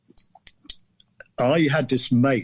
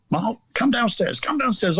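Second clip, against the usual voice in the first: mum's call up the stairs, pitched much higher; mum calling upstairs; 11 semitones higher than usual. Retold or imitated. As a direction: call the boy down from below, high. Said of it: "And then she said".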